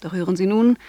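ordinary talk

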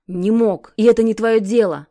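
loud angry growl. A narrator's reading. Kir losing patience with Sasha, growling loudly. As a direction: snarl it out, patience gone. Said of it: "рычу, теряя терпение".

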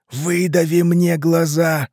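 high, slow, drawn-out croak; hoarse begging. A narrator's reading. Rotting man begs Ivan for release, hoarse pleading. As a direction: rasp out each word imploringly. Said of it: "прохрипел он".

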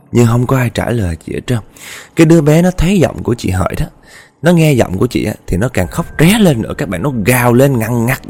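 usual speaking voice

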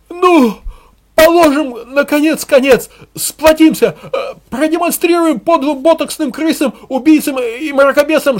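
high, loud demagogue's chant; hysterical rallying shouts. A narrator's reading. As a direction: whip up the crowd with frenzied slogans. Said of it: "Подкликушеские выкрики Мунделя".

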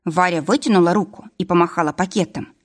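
ordinary delivery

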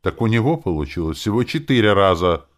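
high, loud, hurt complaint; aggrieved whine of protest; offended. A narrator's reading. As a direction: complain as the slighted one. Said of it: "обиженно заявила Диля".

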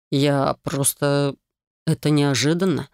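очень медленно, ниже, с паузой; выдавить слова сквозь потрясение; выдавил с трудом. Acted. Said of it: "Наконец выдавил он". (медленно, с трудом выдавливая слова)

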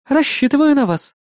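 high, mock-important woman character; self-important. A narrator's reading. Partner relying on her dance companion, self-important. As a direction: say it with playful importance. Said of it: "важно ответила она".

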